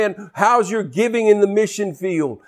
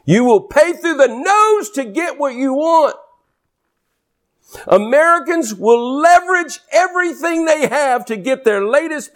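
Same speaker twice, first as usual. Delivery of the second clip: preaching at full force; 7 semitones higher than usual. Acted. Preacher warns the congregation about debt, fervent. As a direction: drive it at the congregation hard.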